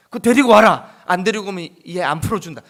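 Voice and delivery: very loud demand, then fast threat; loud commanding ultimatum; ruler's command. Retold or imitated. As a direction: bark the order at them.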